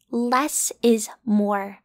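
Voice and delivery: slow emphasis. Acted.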